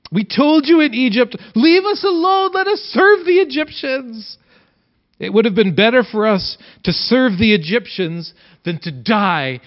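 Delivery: high, loud frantic crowd cry; panicked shouting. Acted. Terrified Israelites shouting at Moses, high and loud panic. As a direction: scream at Moses as if death is coming.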